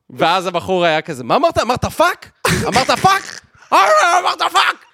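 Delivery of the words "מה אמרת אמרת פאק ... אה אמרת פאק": high, loud, escalating outrage; mock outraged yell. Retelling the man's fury at the tourist, building to high mock outrage. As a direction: builds from disbelief to an indignant shout.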